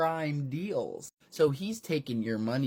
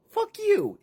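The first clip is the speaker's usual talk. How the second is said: much higher, loud squeaky curse; shrill defiance; 7 dB louder than usual. Acted. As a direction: spit it out in a squeaky shriek. [name], high shriek voice.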